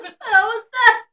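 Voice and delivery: high, loud shocked yelp. Retold or imitated. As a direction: blurt it out stunned. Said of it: "I was like".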